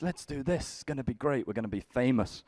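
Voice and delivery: high, hushed mock enthusiasm; keen staff. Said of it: "staff are quite keen".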